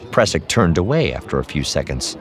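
plain reading voice

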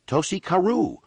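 high frantic cry of the name; frantic scream. A narrator's reading. Albino local screaming the name in panic to strangers, frantic. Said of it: "one of the men screamed frantically".

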